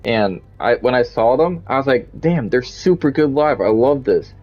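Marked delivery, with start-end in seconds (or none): none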